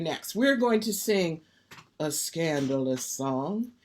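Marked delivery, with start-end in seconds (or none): 2.0-3.7 low, slow and hushed, lifting at the end; playful, drawn-out confidential tone; mock scandalized. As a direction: tease it like naughty gossip. Host teasingly announces a scandalous song.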